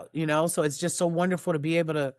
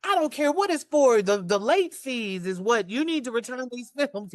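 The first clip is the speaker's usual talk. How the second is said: high-pitched mock telling-off, laughter creeping in; imitated scolding mom; 7 semitones higher than usual. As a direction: play an exasperated mom laying down the law.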